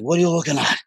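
half-hearted Taken-style grilling; lightly played menacing dad; Liam Neeson impression. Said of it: "And he's like".

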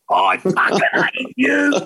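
high, slow, drawn-out mock fury; mock angry driver. Cab driver to rugby player in retold story, mock fury. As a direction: imitate an outraged cabbie without shouting.

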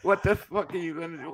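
usual speaking voice

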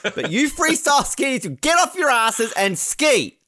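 hammed-up official ordering skiers out; bossy organisers. Organisers ordering freestyle skiers out, mock bossy. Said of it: "the organizers were finally like".

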